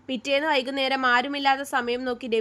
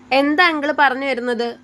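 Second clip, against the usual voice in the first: louder, hard-edged demand; voice hardening; 6 dB louder than usual. A narrator's reading. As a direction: confront him with a harder voice. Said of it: "അവളുടെ ശബ്ദം കടുത്തു".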